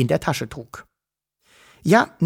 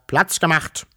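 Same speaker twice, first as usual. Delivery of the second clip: mildly officious order; rasped command; 3 semitones higher than usual. A narrator's reading. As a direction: tell the crowd to clear the way. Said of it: "schnarrte er". (leicht schnarrender Befehl)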